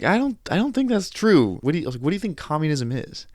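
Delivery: put-on retorting to the older guy in disbelief; incredulous pushback. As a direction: push back like it's absurd. Arguing with the gym guy.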